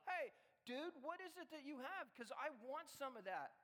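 high, loud, eager call to a friend; eager curiosity. Acted. Onlooker asking a believer what he has, eager. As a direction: act out someone who badly wants in.

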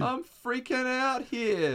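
very high, slow, loud howl; hammed-up meltdown, stretching every word; mock frantic wail. Acting out frantic voicemail apology.

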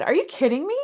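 high, incredulous question; retold disbelief. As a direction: act out stunned disbelief, pitch shooting up. Incredulous reply to friend's revelation.

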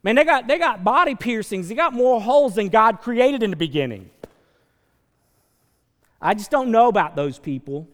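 loud, scandalized mock churchgoer; hammed-up outrage at piercings, loud; playing the shocked judge, loudly; loud judging. Acted judgmental churchgoer.

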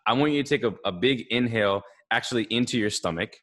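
ordinary delivery

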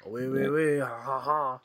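hammed-up French accent with staged laugh; mock French. Joking about the French Harry, mock French accent. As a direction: play a cartoon Frenchman. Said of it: "He was French".

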